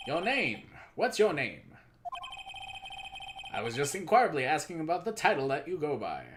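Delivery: grandiose, self-important company boss; pompous executive. Acted. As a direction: play a pompous, verbose tycoon. Voices pompous game character.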